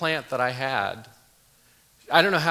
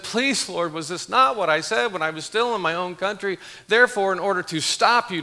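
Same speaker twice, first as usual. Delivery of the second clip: impassioned scripture reading; 3 semitones higher than usual. Acted. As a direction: plead with the Lord like an aggrieved prophet. Reads Jonah's angry prayer aloud.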